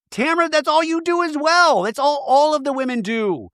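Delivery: high-pitched exasperated mock protest; high, hammed-up exasperation. Acting exasperated reply to Tamara.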